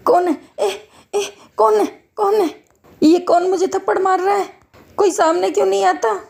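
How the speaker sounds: high, frightened cry; panicked yelping. Acted. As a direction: yelp as unseen slaps land. Invisible someone is slapping him.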